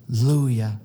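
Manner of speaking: ordinary talk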